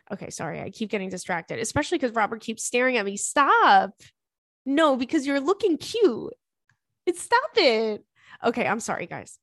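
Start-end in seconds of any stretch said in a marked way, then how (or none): 3.2-3.9 short, louder stop to the dog; firm stop to dog. Real, not acted. Scolding her dog Robert for staring at her, a firm loud stop. 4.6-8.0 higher, drawn-out doting pet talk; cooing pet voice. Real, not acted. Fondly scolding her dog Robert, who looks cute, in a doting pet voice.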